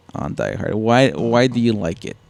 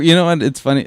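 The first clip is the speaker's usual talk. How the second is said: ordinary delivery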